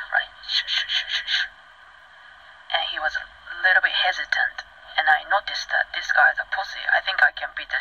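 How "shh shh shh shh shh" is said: sharp repeated shh swishes; whooshing sound effect made by mouth; imitated swishing. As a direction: mimic swinging a bottle through the air. Mimics swinging the water bottle.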